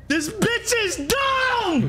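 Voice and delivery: high, slow, drawn-out yell; exasperated shout. Real, not acted. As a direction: scream it out in disbelief. Mocking partner's dumb question.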